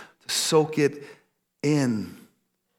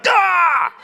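long, loud high "gah!"; triumphant yell; 9 dB louder than usual. Shown as a demonstration. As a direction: burst out yelling on finishing a great book. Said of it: "And I just yell".

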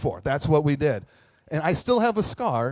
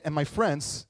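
normal talking voice

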